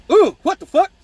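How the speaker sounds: high, loud startled yelp; mock pained outcry. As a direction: cry out as if you just crashed. Acted cry after hitting headboard.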